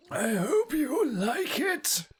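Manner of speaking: high, slow, wavering sing-song; character voice. Acted. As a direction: croon it slowly like a quavering villager. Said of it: "remind me of this, uh, voice".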